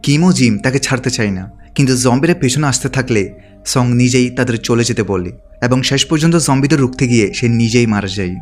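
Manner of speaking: usual speaking voice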